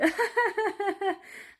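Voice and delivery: a burst of high, giggling laughter; high helpless laugh. Real, not acted. To viewers about her intense videos, laughing high.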